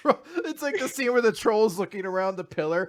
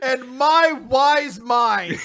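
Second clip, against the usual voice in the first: pompous; 4 semitones higher, 6 dB louder, much slower than usual. Retold or imitated. Sen offers his wisdom, mock solemn.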